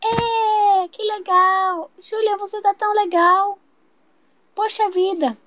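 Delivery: put-on very high, excited child squeals; thrilled little sister cheering; delighted little girl. Little sister thrilled with her new playmate, very high and excited.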